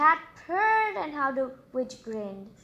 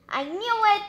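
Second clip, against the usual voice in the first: excited; 8 semitones higher, 6 dB louder, faster than usual. Real, not acted. Guessed the bird right.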